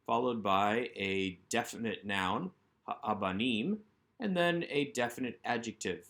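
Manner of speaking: normal talking voice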